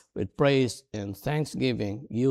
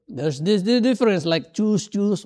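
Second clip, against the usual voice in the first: high, loud corrective tone; louder, excited pointing out of a difference; animated mock correction; 8 semitones higher, 6 dB louder than usual. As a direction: act out an insistent language correction, pitched up. Acts out spouse correcting pronunciation.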